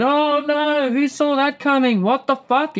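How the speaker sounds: high, stunned outcry; high comic disbelief all through; shocked gasp. Retold or imitated. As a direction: react like the twist floored you. Everyone reacts to the twist.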